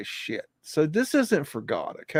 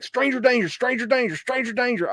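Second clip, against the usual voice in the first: hammed-up panic in a raised pitch; mock scream; 8 semitones higher than usual. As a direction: chant it like a scared child's warning. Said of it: "I'd be, like, screaming".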